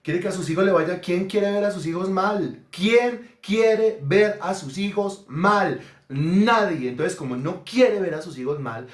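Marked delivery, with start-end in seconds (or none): 2.7-5.8 very slow, word by word; slow emphatic repetition. Real, not acted. Preacher challenging parents about their children, slow and emphatic. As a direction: space out every word to drive it home. Question repeated slowly for emphasis.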